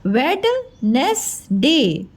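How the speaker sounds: वेडनेसडे slowly as three pieces, wed-nes-day; spell-it-out वेडनेसडे: wed, nes, day; spelling pronunciation. Shown as a demonstration. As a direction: sound the D in वेडनेसडे, pausing between syllables. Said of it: "लिखना है ऐसे हमको".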